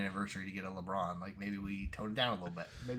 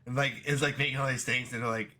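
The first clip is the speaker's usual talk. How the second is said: ordinary talk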